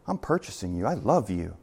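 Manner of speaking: low, soft and tender; a soft, tender Jesus voice. Pretend. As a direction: speak gently, like a promise of love. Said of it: "he still says".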